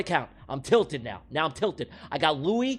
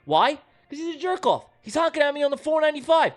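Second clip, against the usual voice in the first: higher, indignant whine; indignant rant; 6 semitones higher than usual. Retold or imitated. Driver ranting about Tony honking on the freeway. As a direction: complain hotly about the honker.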